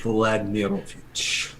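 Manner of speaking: put-on low, slow, sultry Russian accent; sexy Russian accent. Player shows off the mech's name, sexy Russian accent. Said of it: "say it in a sexy Russian accent".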